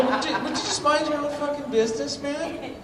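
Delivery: high-pitched exasperated retort. Retold or imitated. Telling the neighbor to back off, high and exasperated. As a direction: rise high in disbelief. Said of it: "I go".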